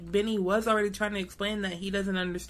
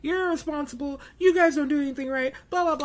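mock parent yelling; 6 semitones higher, faster than usual. Parent scolding the kids, mock angry yell.

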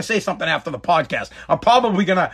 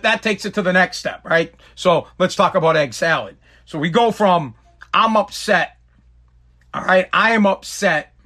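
ordinary talk

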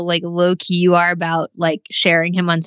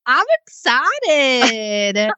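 slow, drawn-out teasing echo; mocking echo; 5 semitones higher, much slower than usual. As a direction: stretch it out to tease. Echoing her mom's excited line.

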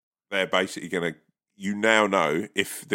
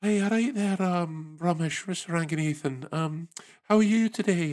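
put-on gentle Scottish lilt, well above usual pitch; Edinburgh accent; 9 semitones higher than usual. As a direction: soothe the caller in a sweet, high-pitched Edinburgh accent. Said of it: "Luke up in Edinburgh".

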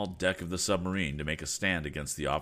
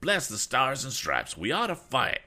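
bursting out; 8 semitones higher, 4 dB louder than usual. A narrator's reading. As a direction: burst out with it, rousing the others. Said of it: "burst out Mister Damon".

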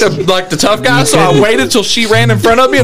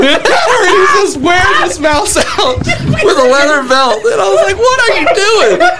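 laughing; 8 semitones higher than usual. Real, not acted. Laughing retelling.

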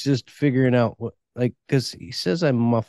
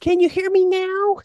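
high squeaky voice; high mock voice. Mocking the phone ad line.

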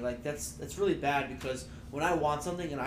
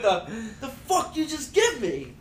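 mock kid outrage; 8 semitones higher, 4 dB louder than usual. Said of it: "I was that kid".

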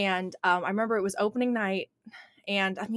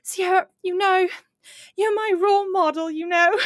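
put-on British child accent; 11 semitones higher, 7 dB louder than usual. Retelling her student's words in a British child accent. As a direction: imitate a sweet little British girl. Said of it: "in her beautiful little British accent".

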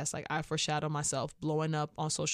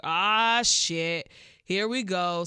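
exasperated groan; 6 dB louder, much slower than usual. Retold or imitated. Exasperated reaction to pickup line.

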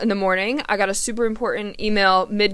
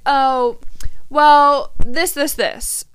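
dismissive; 4 semitones higher, 4 dB louder, much slower than usual. Retold or imitated. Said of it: "they're gonna downplay it".

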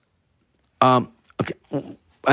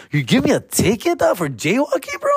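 high, incredulous protest; can't believe the ticket; hammed-up disbelief; mock disbelief; 13 semitones higher than usual.

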